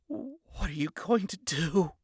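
slow, shaky with fear and rage; quivering voice. Acted. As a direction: tremble as you ask. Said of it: "in a quivering voice, equal parts rage and fear".

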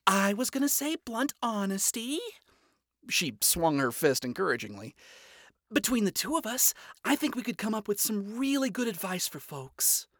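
upbeat and eager; cheerful young woman. Acted. Charlie voiced in story.

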